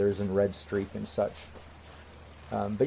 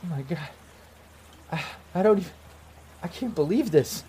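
higher, faltering, subdued wonder; crying in disbelief; 6 semitones higher, 4 dB quieter than usual. Acted. Ex-girlfriend taking in the reunion, in hushed acted disbelief. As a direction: trail through broken phrases, overcome. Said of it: "And she's just crying".

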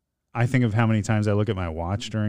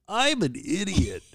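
high, slow, drawn-out silly voice; put-on catchphrase; 10 semitones higher than usual. Husband gives the podcast a drop, slow put-on voice. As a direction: stretch it out like a high-pitched audio drop.

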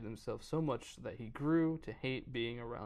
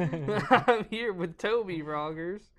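loud, high read through laughter; slow, raised voice with laughter behind it; laughing read; 7 semitones higher, 12 dB louder, slower than usual. Acted. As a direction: carry on reading while cracking up. Laughing over misread name, resumes reading.

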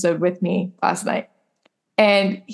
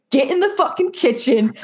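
higher-pitched imitation of a bossy boyfriend; jokey retold order, not truly angry; mock bossy order; 5 semitones higher than usual. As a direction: play-act a domineering command. Voices boyfriend's joke show of confidence.

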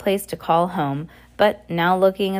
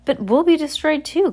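alarmed; 10 semitones higher, 4 dB louder than usual. Acted. Klaus alarmed by the threat.

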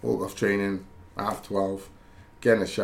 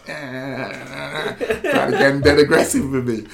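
laughing; 6 semitones higher, 7 dB louder, much slower than usual. Real, not acted. Laughing at friend's prank call.

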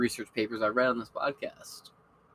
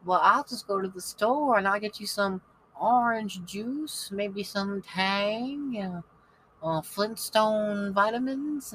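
much higher, slow Southern granny voice; sweet Southern old lady; 10 semitones higher, slower than usual. Retold or imitated. Ninety-four-year-old Lily offering her sick roommates soup, high Southern coo. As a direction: coo like a kindly Southern grandmother. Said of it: "So now she's like".

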